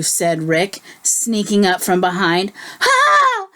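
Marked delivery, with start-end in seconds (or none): 2.8-3.5 high startled howl. A narrator's reading. Bear howling when the bee stings. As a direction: yelp it out high. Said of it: "howled Buford".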